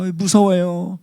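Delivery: high, mock-frightened little voice; scared child. Child in the dark, acting scared. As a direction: whine it like a frightened kid. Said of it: "어두운 분위기가 되면은 무서워".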